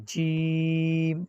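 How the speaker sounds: "جیم" with the vowel held for five counts; teacher demonstrating madd on jeem; elongated jeem. Shown as a demonstration. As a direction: stretch "جیم" long and steady. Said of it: "یہاں پر جیم کو پانچ الف کھینچنا ہے".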